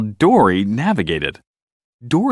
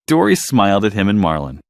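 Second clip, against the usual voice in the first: plain reading voice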